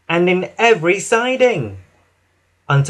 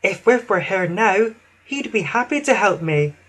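higher, regretful sighing voice; Emily; 5 semitones higher than usual. A narrator's reading. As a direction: sigh it with mild regret. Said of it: "Emily sighed".

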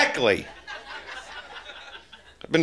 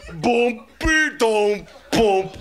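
mock drumbeat booms; 6 semitones higher, much slower than usual. Imitating camel string.

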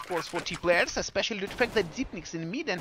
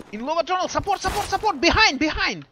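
higher, urgent calling; excited play-by-play; 9 semitones higher, faster than usual. Real, not acted. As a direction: call the action fast and urgent. Live call of fight.

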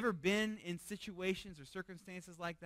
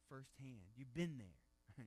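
ordinary talk

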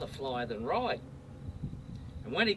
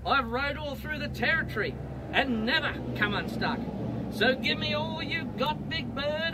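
mighty roar; 6 semitones higher than usual. Acted. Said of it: "Billy gave a mighty roar".

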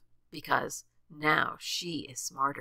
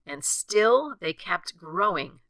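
ordinary delivery

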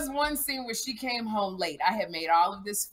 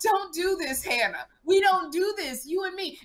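acted pleading mother; 9 semitones higher than usual. Acts out mother pleading with daughter.

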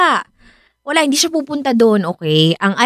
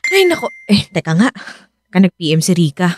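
normal talking voice

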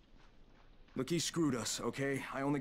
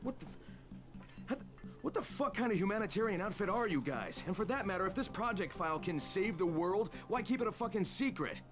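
angry; 7 semitones higher than usual. Acted. Crane angry at the handler.

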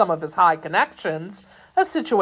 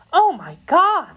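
high, drawn-out cry of alarm; alarmed exclamation; 11 semitones higher, 5 dB louder than usual. A narrator's reading. Kitty realising who the ugly man is, exclaiming. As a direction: exclaim slowly, high and louder. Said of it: "exclaimed Kitty".

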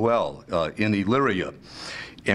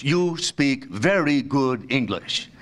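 much slower, each word measured out; slow, patronizing repeat; slower than usual. Retold or imitated. Man praising a supposed foreigner's English, slowly and deliberately. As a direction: spell it out slowly for a non-native speaker.

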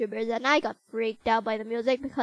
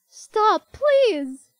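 higher, louder plea from afar; distant call; 9 semitones higher, 8 dB louder, slower than usual. Acted. Toriel shouting after the dog that took her phone.

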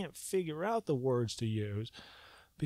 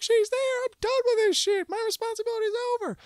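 much higher, louder excited squeal; relieved realization; 6 dB louder than usual. Retold or imitated. Realizing his wife is ready, a high relieved squeal. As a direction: blurt it out in relief. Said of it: "I was like".

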